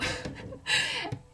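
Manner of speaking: high-pitched burst of laughter; real high laugh. Real, not acted. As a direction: crack up at your own punchline. Laughs at her doctor story.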